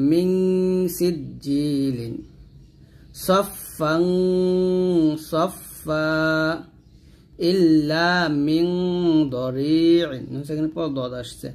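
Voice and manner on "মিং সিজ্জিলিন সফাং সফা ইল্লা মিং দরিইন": long hummed ng in "মিং" and "সফাং"; nasal ghunnah. Shown as a demonstration. Teacher reciting examples for learners, "সফাং" with held nasal. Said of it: "গুণ করবেন".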